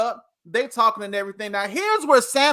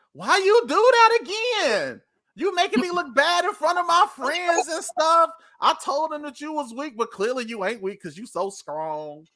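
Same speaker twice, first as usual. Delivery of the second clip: put-on higher, whiny complaining girlfriend; mad Delilah; 4 semitones higher than usual. Delilah scolding Samson for embarrassing her, higher whine. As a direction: pitch it up and whine at him.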